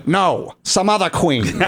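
slow, imperious drag queen impression; weirdly Jamaican, strangled-regal voice; Vivacious. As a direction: draw it out like a regal diva. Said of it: "Oh, you have Vivacious's voice down".

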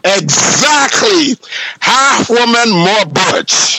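high, drawn-out sassy exactly; campy sass. Real, not acted. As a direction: camp it up, slow and high. Camp reply about drag.

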